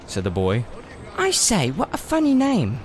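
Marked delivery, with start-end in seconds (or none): 1.2-2.8 put-on very high, girlish teasing voice; a cheeky girl mocking a name; Polly teasing. A narrator's reading. Narrator voicing Polly laughing at Diggory's name. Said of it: "said Polly".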